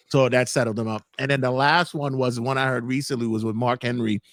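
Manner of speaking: ordinary delivery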